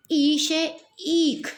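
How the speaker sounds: ई से ईख with the ई drawn out long; लंबा दीर्घ ई खींचकर बोलें. Shown as a demonstration. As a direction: stretch the long vowel ई in ईख slowly. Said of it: "इन दोनों के उच्चारण में ही भेद है".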